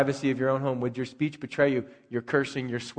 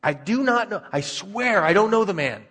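higher, insistent oath; sworn denial; 6 semitones higher, 3 dB louder than usual. Acted. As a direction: swear it in a raised pitch, acting out the denial. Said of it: "he denied with an oath".

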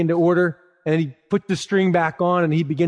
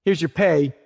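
usual speaking voice

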